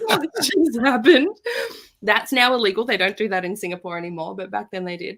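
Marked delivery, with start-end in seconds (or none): 0.1-1.4 slow, high and louder through laughter; laughing disbelief. Retold or imitated. Parrot picks a card.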